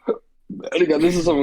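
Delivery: talking through hiccups. Real, not acted. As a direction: hiccup between the words. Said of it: "Sorry, I have the hiccups".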